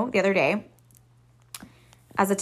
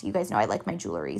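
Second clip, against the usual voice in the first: ordinary talk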